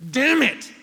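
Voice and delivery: sudden loud high curse; angry outburst. Retold or imitated. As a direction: slam it out in frustration. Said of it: "threw it down and went".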